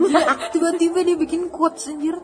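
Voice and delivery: cracking up at the sudden quote; laughing teasing. Real, not acted. Teasing a friend for sounding like a quote book, laughing.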